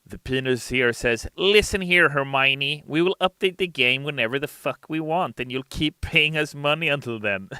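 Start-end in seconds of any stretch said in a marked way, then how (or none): 1.3-7.5 greedy developer voice. Acted. Reading crude chat in character.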